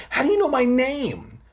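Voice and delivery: very high, startled question; alarmed stammerer. A narrator's reading. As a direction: voice shoots up in alarm. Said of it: "I stammered".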